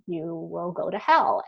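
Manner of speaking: normal talking voice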